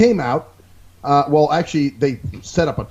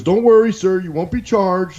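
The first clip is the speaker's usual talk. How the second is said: placating rep; 5 semitones higher, 3 dB louder than usual. Retold or imitated.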